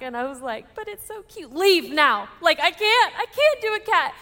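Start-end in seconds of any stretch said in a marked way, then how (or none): 0.7-1.5 high, very soft mock coo; retold cooing plea. Reacting to the kitten before sending it away, soft mock cooing. 1.5-4.1 loud, rising mock outburst; retold upset refusal. New mother sending the cat away, loud acted refusal.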